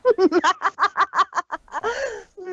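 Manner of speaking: high crazed cackle; demented laugh. Acted. Villain revealing herself with a high crazed cackle. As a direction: cackle like a madwoman. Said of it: "gave a completely demented laugh".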